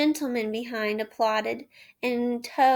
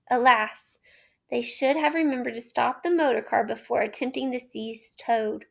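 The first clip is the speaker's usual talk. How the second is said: plain reading voice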